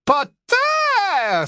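piercing, very high drawn-out shriek; piercing cry. Acted. Bellatrix cries out on seeing her captive, piercing cry. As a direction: let the name ring out in a high, piercing cry. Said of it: "s'écria Bellatrix d'une voix perçante".

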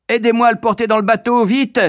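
appel aigu et rapide, plus fort; elle hurle à l'aide, vite; cri pressant. A narrator's reading. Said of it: "cria la grande sœur". (la grande sœur crie aux enfants de l'aider, pressante)

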